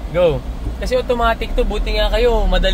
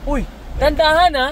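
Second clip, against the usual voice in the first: urgent caution; 8 semitones higher than usual. Real, not acted. Coach warning child to go slow.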